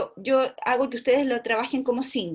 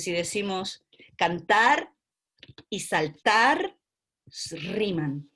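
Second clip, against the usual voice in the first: cantar dan saltar pelan ditarik; slow rhyme demo; much slower than usual. Shown as a demonstration. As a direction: ucapkan pelan, tonjolkan akhiran -tar. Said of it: "conciencia de rima".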